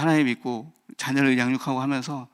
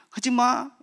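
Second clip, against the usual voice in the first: put-on high, sharp child's "don't do that"; mimicking bossy daughter; 10 semitones higher, slower than usual. Father imitates daughter scolding her little brother, high and bossy. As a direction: squeak it like a bossy little girl. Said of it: "이러면서".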